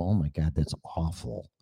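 hushed, appalled at own voice; disgusted whisper. Retold or imitated. Hearing own recording, whispered disgust. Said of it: "It's fucking horrible".

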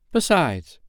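put-on self-assured boy. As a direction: say it fully believing yourself. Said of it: "quite believing himself".

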